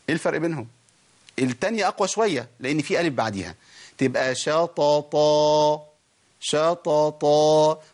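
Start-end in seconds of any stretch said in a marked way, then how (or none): 4.4-7.8 شططا drawn out slowly with a full, heavy ṭāʾ; correct shatata. Shown as a demonstration. Teacher demonstrating شططا slowly to students. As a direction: stretch شططا slowly, pressing both ṭāʾ sounds. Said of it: "ما قولش شططا".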